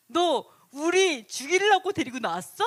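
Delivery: acted indignant challenge from the crowd; resentful accusation. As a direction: throw it out as an accusation. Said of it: "원망을 해요".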